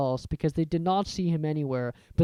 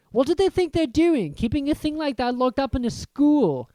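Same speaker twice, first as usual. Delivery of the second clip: Ron; 9 semitones higher, 5 dB louder than usual. A narrator's reading. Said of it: "said Ron finally".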